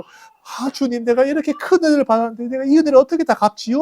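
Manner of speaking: mock-tearful high plea of thanks; retold wailing prayer. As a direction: imitate the leper lamenting to the Lord. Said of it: "막 이렇게 통곡을 하면서 기도를 하고".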